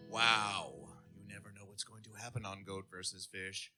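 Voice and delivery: ordinary delivery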